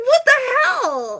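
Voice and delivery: high, slow, voice cracking in disbelief; half-crying what the hell; retold disbelief close to tears; tearful disbelief. Retelling being kicked out.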